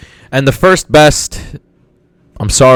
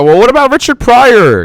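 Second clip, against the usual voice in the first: much higher, louder challenging interjection; a skeptical fan cutting in, loud and high; mock heckler; 11 semitones higher, 4 dB louder than usual. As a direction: shoot back like a know-it-all fan. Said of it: "I know you go".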